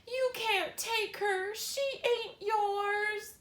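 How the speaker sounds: put-on boy screaming at his father over his dead sister; anguished scream. A narrator's reading. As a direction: scream it through tears. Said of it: "Bryce screamed".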